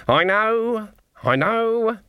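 put-on weary old witch. Narrator voices Granny.

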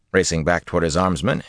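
usual speaking voice

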